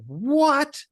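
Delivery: put-on a little kid who can't believe the price; outraged little boy. Son hears the five-dollar water price, shocked high kid voice. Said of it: "my seven or eight-year-old son said".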